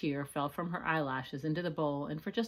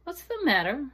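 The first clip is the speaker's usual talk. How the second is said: higher, louder caring question; concerned mother; 10 semitones higher, 8 dB louder than usual. Acted. Mother asking her upset daughter, concerned. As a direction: ask your worried child gently.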